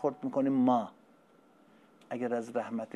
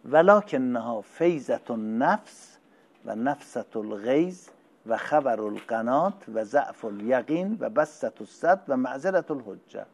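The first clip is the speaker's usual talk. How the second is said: full-force preaching; 7 dB louder, slower than usual. Acted. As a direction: proclaim it to the whole hall. Recites Arabic sermon. (خطابهٔ پرشور، بلند و کشیده)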